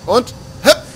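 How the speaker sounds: a sudden high yelp on the jump; high hop cry. Real, not acted. Player jumping a gap, high excited yelp. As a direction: squeak it out as you leap.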